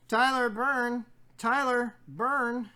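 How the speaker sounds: loud, high, drawn-out announcement of a name; excited name call. Real, not acted. Hyping up the team's red deck player, loud and drawn out.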